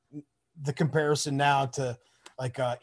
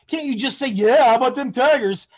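higher, louder mock disbelief; exasperated plea; 9 semitones higher, 7 dB louder than usual. Friend Brad asking why he couldn't just agree, exasperated. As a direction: plead like it was so obvious.

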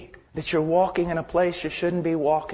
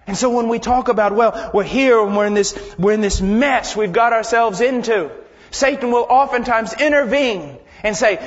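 loud, driving sermon voice; urgent pulpit delivery; forceful preaching; 5 semitones higher, 8 dB louder than usual. Real, not acted. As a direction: press the point with raised voice.

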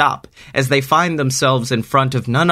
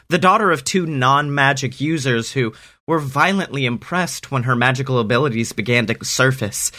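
ordinary delivery

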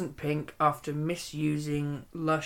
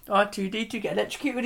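ordinary delivery

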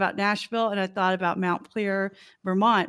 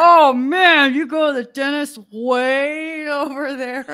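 loud, high, laughing mock voice; mocking quote; 5 semitones higher, 7 dB louder, slower than usual. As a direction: ham up a know-it-all local. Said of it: "like, people go".

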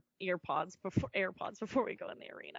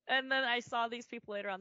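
ordinary talk, nothing marked

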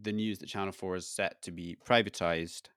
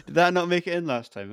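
laughing question; 9 semitones higher, 10 dB louder than usual. Real, not acted. Laughing at co-host's complaint.

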